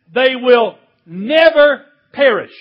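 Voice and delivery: loud, slow "never", then a pause before "perish"; stress on never. Shown as a demonstration. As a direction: punch "never" loudly and stretch it. Quoting verse with emphatic pause.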